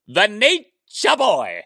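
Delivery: slow, drawn-out boast; Ric Flair. Retold or imitated. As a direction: stretch out the nickname proudly. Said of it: "as he likes to say".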